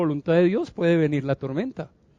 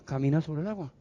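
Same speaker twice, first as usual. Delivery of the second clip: hushed repeat; 3 semitones lower, 10 dB quieter, faster than usual. Acted. Repeated phrase, second take hushed.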